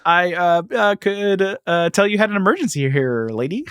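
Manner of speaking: awkward Batman. Retold or imitated. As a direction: hesitate through it with a forced smile. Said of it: "like, the most awkward look on his face".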